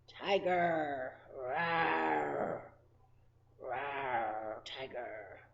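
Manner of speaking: low, growly tiger voice; mock tiger roar for little kids. Teacher voicing tiger roar.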